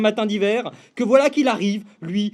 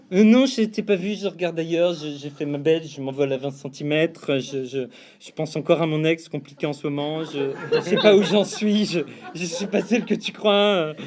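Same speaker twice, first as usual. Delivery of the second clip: coy female voice; 6 semitones lower than usual. Retold or imitated. Voicing the coy female pigeon.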